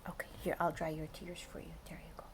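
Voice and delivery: fast, soft comforting whisper; tender whisper. Real, not acted. Pretends to dry child's tears.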